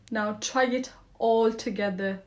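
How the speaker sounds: ordinary talk, nothing marked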